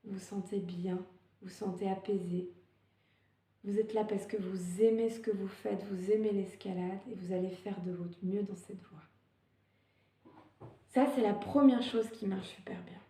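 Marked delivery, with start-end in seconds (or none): 0.0-10.6 softer, guided-relaxation tone; calm soothing voice. Shown as a demonstration. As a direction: soothe the climber before the climb. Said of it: "ce bien-être dans le ventre".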